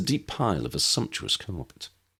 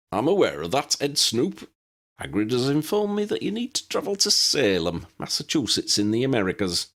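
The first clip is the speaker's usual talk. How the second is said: lightly voiced elderly wizard, higher; a hint of a fussy old wizard; 6 semitones higher than usual. A narrator's reading.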